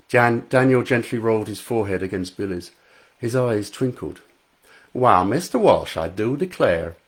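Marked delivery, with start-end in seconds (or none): 4.9-7.0 hammed-up Southern US accent; faux Southern accent. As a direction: play the coy Southern gentleman in a Southern US accent. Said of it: "Daniel spoke in a faux Southern U S accent".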